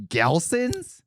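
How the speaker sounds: high rising shocked question; incredulous. Retold or imitated. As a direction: sound shocked at the name. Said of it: "And I was like".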